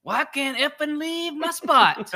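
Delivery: high, slow, hammed-up complaint; imitated guy. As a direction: mimic him in a high whiny voice. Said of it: "he just starts going".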